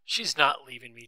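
loud, forceful denial; angry shout. A narrator's reading. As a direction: shout it down furiously. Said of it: "Tom shouted".

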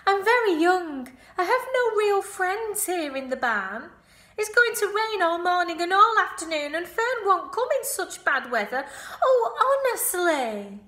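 high, loud whiny piglet voice; lonely complaint. Acted. Wilbur complaining that nobody will come. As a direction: wail like a self-pitying little pig.

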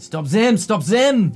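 put-on loud, high shouting with a French accent; Dwarven French accent. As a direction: yell it like a French-accented dwarf guard. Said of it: "You hear shouts in the back in Dwarven French accent".

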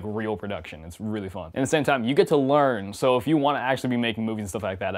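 ordinary talk

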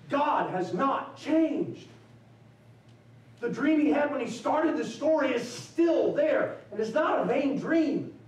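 soaring pulpit close; sermon climax. Acted. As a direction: lift it with triumphant conviction. Preacher's closing climax.

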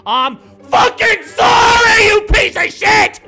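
slow furious shout; bitter sarcastic yell; raging gamer. Real, not acted. Sarcastic angry apology.

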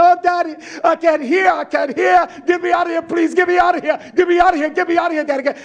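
high, loud panicked pleading; frantic child's plea. Retold or imitated. Preacher voicing a daughter begging her dad after the blast, panicked loud plea. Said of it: "she said".

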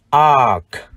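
"ark" as a long ah closed by k; model rhyme. Shown as a demonstration. Teacher shows the rhyme, "ark" as long ah plus k. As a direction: say "ark" with no r heard and a released k. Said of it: "Vần này chúng ta đọc là".

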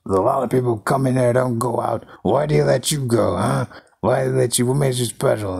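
high, quick, menacing hobo voice; crazy bum. Retold or imitated. Random bum pulling a knife on the escapee, menacing. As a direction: press him like an unhinged drifter.

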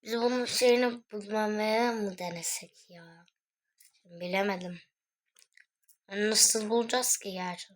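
ordinary talk

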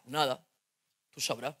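low, quiet sulk; sulky boyfriend. Retold or imitated. Boyfriend sulking at girlfriend, low and quiet. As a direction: mutter it sulkily. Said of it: "Antonio, ¿qué te pasa?".

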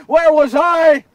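high, loud, anguished demand; shouted self-reproach. Acted. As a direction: cry out in fury at yourself. Repeated question at full force.

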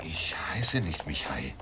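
urgent hushed denial; whisper. Acted. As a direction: whisper it so no one overhears. Denies identity under watch.

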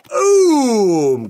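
loud, high celebratory shout; excited boom. Real, not acted. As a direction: boom it out in triumph. Celebrates a pulled card.

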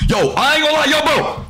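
high-pitched, worked-up protest; exasperated outburst. Real, not acted. Frustrated venting at the game.

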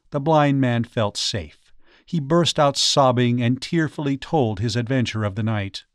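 ordinary delivery, nothing marked

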